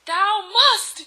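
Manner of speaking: high, loud villain insistence; mock-archaic shouted order; Voldemort yelling. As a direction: bellow it like a hammy tyrant. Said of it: "he yelled".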